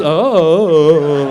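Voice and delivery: long, vacant "oh"; spaced-out son. Retold or imitated. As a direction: mimic an oblivious, dazed boy. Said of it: "My son, he's just".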